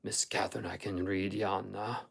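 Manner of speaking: halting and low, shyly proud; awkward elation. A narrator's reading. Hareton shows Catherine he can read, awkward elation. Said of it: "said with a stupid mixture of awkwardness and elation".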